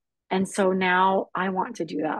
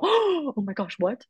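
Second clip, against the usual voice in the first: hammed-up gasp and high exclamation; mock shocked gasp; 5 semitones higher than usual. Showing how her friend didn't react, mock shock. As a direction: imitate someone scandalised, voice lifted. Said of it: "she didn't go".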